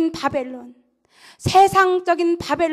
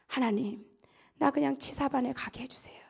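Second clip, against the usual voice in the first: hushed, breathless plea; whispered prayer; 10 dB quieter than usual. Retold or imitated. Private whispers his prayer through the night, hushed. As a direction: whisper it to God in the dark.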